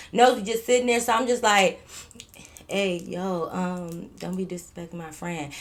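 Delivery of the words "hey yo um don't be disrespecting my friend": very soft, nervous half-whisper; timid whisper. Retold or imitated. Standing up for her friend in a scared soft whisper. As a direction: hush it out scared to be heard. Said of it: "I tried to whisper that shit".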